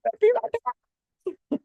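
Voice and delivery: high squeaky voice hurrying off; imitating Mark fleeing the séance in a high squeak; mock fleeing Mark. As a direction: squeak it out like a panicked man bolting. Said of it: "And he's like".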